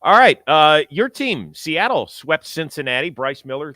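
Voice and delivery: ordinary talk, nothing marked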